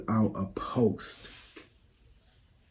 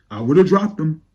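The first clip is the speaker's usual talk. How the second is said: louder, higher insistence; emphatic; 5 semitones higher, 6 dB louder than usual. Real, not acted. Fan insisting she'd have released it, louder. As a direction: insist on it the second time.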